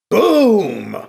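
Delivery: loud, drawn-out falling "boom"; big voiced explosion; imitated explosion boom. A narrator's reading. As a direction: boom it out like a blast.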